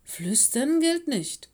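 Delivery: high, loud, slow reproach; indignant. A narrator's reading. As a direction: scold the class in drawn-out outrage. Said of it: "sagte sie empört".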